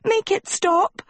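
very high, desperate plea; pained cry. A narrator's reading. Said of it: "Sophie cried, dancing with pain".

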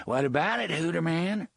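menacing question. A narrator's reading. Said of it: "soft, terrible voice".

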